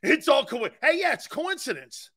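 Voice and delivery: ordinary delivery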